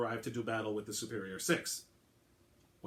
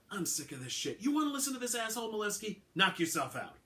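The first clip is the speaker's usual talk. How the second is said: higher-pitched fed-up cop walking out; disgusted detective; 10 semitones higher than usual. A narrator's reading. Alvarado voiced in audiobook.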